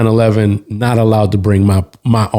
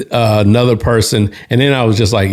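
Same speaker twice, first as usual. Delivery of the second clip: plain reading voice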